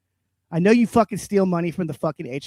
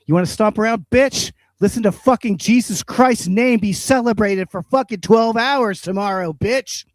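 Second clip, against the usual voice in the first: raised, higher angry yelling at the neighbor; angry rant; 5 semitones higher, 4 dB louder than usual. Real, not acted. Ranting at the stomping neighbor next door, loud and angry. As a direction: shout him down, taunting and furious.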